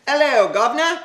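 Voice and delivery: high, loud hammed-up Cockney greeting; mock London cheer, high and loud; British accent. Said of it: "What country are you from now?".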